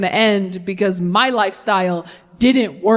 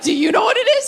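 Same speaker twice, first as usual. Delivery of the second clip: put-on indignant kid; 10 semitones higher, faster than usual. Kid turning question back.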